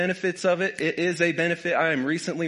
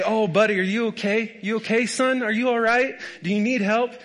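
higher, louder fussing concern; mock doting parent; 6 semitones higher, 3 dB louder than usual. Preacher mocking the fussing parent his dad never was. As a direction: gush over the hurt kid like a worried parent. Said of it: "Not a".

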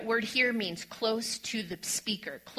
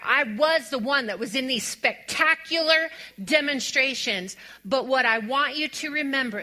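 loud, rising preacher's proclamation; fiery preaching; 6 dB louder than usual. Acted. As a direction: build it like a sermon climax. Preaching builds to full force.

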